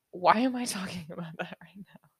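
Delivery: breathy whisper with a laugh inside. Shown as a demonstration. Catching herself mid-story, whispering to listeners. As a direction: giggle it under your breath. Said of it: "I can only masturbate quietly".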